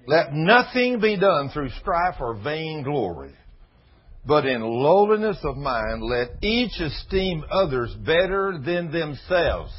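slow, loud preaching of the verse; preached scripture. Acted. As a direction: proclaim the verse, word by word. Reads scripture with force.

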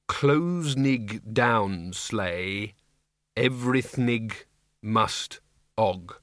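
very slow, sounding out "Closnig" and "Everythnig" as misspelt; deciphering misprints. A narrator's reading. Windle making out blotchy words, "Closnig" sounded out slowly. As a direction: read "Everythnig must og" haltingly, exactly as printed.

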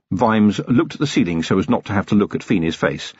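ordinary delivery, nothing marked

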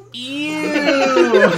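high, loud "Ew" dissolving into laughter; laughing mock disgust. Retelling a classmate's squeal of disgust, laughing. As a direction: imitate his squeal of disgust and crack up. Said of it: "And he goes".

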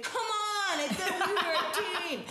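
high, loud, laughing mock whine; laughing high mock plea to the kids; whiny plea. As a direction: whine it like a betrayed kid.

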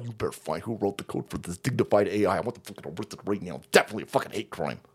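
mock outraged voice. Host mimics offended women, low and deadpan. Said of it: "they're sitting there going".

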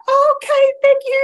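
very high, loud imitated thanks; polite recipient's thanks. Church ladies given a painted card, high polite thanks. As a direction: mimic a lady accepting a card.

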